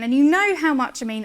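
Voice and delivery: ordinary talk, nothing marked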